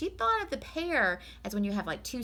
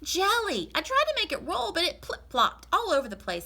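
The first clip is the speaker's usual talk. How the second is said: much higher, eager to please, getting louder; Amelia Bedelia; 11 semitones higher, 6 dB louder than usual. Acted. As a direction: earnestly promise the master. Amelia explains.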